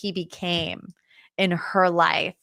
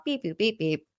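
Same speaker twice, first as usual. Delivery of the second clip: softer little robot beeping; robot beeps; 3 semitones lower, 7 dB quieter than usual. Acted. Host mimicking Robot Rachel powering down, robot beeps. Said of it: "Robot Rachel is powering down".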